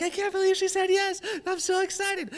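high, bright shout of joy; giddy excitement. Retold or imitated. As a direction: gush like a teen who just got a date. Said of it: "I'm like".

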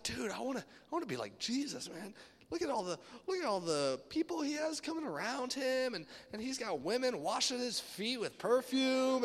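high, eager fanboy gushing; excited disciples. Acted. Disciples gushing to each other about following Jesus, excited.

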